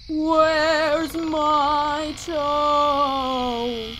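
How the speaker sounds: slow, high, drawn-out ghostly moan; ghostly groan. Acted. Ghost voice in a scary story.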